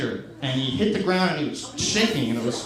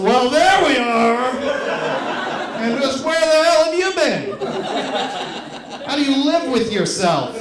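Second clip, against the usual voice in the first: high, loud, slow mock scolding; sarcastic welcome turning to outraged scolding; scolding the train; 6 semitones higher, 4 dB louder, slower than usual. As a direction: chew out the late train like a person. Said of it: "you talk to it like it's a person".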